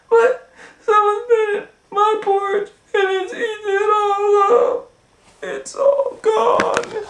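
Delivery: put-on very high, whiny crying baby voice; Baby Bear. Baby Bear finding his porridge gone, high and wailing. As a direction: wail it like a tiny cub. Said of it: "And Baby Bear said".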